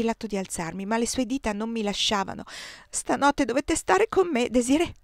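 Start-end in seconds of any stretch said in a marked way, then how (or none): 2.9-4.9 trembling lips. A narrator's reading. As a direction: beg her to stay, lips trembling. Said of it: "dissero le sue labbra sussultanti".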